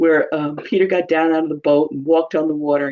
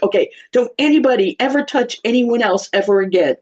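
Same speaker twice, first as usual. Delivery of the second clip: raised-pitch mock parental order; exasperated parent; 6 semitones higher than usual. Parent orders bickering kids apart, mock exasperation. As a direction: lay down the law, hammed-up. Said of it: "saying ridiculous things like".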